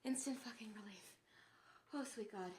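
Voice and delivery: soft relieved murmur; relieved sigh. Real, not acted. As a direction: breathe it out in relief. Relief after warm socks.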